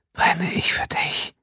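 high, slow breathy whisper; passionate whisper. Acted. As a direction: draw it out, burning with desire. Lover whispers a poetic line.

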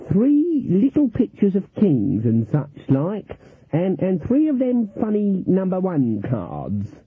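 put-on high, slow, dithering old lady; Granny Weatherwax feigning innocence at cards; mock-innocent old granny. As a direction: play the confused harmless granny.

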